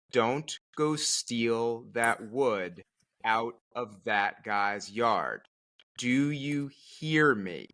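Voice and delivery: slow, deliberate stern warning; serious warning. Retold or imitated. Wife warning husband not to steal wood, slow and stern. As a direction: spell it out slowly like a stern wife. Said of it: "with her most serious expression and said".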